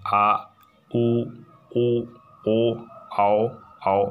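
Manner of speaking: आ, उ, ओ sounded from the back of the tongue; drawn-out back vowels, one by one. Shown as a demonstration. Teacher demonstrating back vowels to students. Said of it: "ऐसा बोलते हैं".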